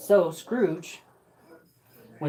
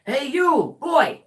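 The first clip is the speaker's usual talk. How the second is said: calling out; 8 semitones higher, 6 dB louder, slower than usual. Acted. Scrooge calls from window.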